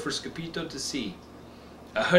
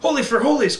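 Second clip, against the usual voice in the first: high, loud exclaim; excited cry; 11 semitones higher, 7 dB louder than usual. Acted. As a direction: burst it out, thrilled. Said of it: "cried Scapito".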